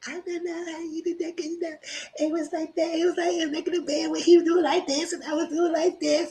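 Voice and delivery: very high, breathy mock-girly voice, nearly crying; mocking a giggly juror. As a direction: squeak it out like a silly giddy juror. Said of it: "all the time she was like this".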